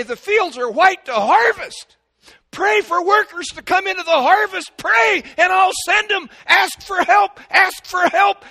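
loud, high, shouted exhortation; preaching at full force. Real, not acted. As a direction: drive each plea home at a full shout.

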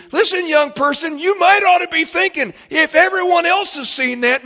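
high, loud pleading preacher's cry; preaching at full force. Acted. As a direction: urge the young person to listen.

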